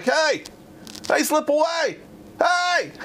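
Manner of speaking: high-pitched calling out, climbing each time; retold warning call. Jumper warning a man coming right at him, urgent high calls. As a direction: call out urgently in a high voice, without full volume.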